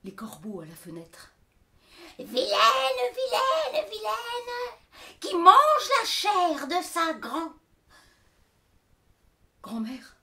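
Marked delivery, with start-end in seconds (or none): 2.2-7.5 very high, slow shouted crow chant; cawing crows. Acted. Crows accuse the girl. 9.6-10.2 unvoiced frightened whisper; scared girl whispering to grandmother.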